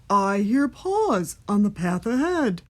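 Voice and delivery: loud storybook monster voice; Gruffalo voice. A narrator's reading. Gruffalo hears something ahead, loud monster voice. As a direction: announce it as a big beast. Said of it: "till the Gruffalo said".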